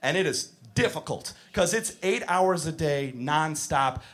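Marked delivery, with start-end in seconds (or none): none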